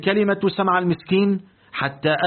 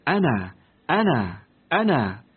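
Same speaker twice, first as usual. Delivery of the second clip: bride's voice in the lamplight; 3 semitones lower than usual. A narrator's reading. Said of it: "وجه عروس تقول له".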